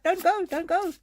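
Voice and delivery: high pleading "don't go", voicing the departed; high, light pleading voice; pleading spirit voice.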